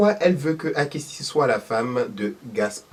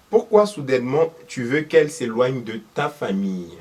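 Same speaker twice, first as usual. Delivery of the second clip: ordinary talk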